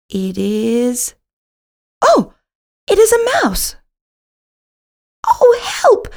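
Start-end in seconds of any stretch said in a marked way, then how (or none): 2.0-3.8 high, loud gasp of discovery; startled, voice leaping up at the mouse; sudden surprise. A narrator's reading. Narrator spots a mouse. 5.2-6.1 high, loud scared cry for help; a kitten squealing in fright; frightened cry. A narrator's reading. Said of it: "cries Pinky".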